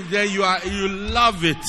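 usual speaking voice

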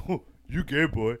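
put-on voice of a guy he knew; lightly mimicked real guy; impersonated acquaintance. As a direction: imitate an acquaintance's odd way of talking. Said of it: "that's literally how he acted".

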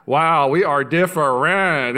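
slow, loud, drawn-out realisation; mock amazement. Husband reacting to his wife's singing, slow mock amazement. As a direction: stretch each word in exaggerated wonder.

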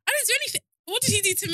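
high, loud indignant protest. Real, not acted. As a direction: protest your innocence, outraged. Indignant denial of blame.

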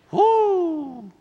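long, high falling "woo"; mock-alarmed whoop. Preacher reacts to moving to Haiti, high mock whoop.

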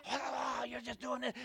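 normal talking voice

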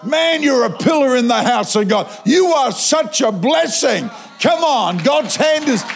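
high, effusive hailing from afar; shower him with hammed-up praise, pitched high; calling out praise. Preacher hailing the man from the doors, effusive encouragement. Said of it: "I start from the doors over there".

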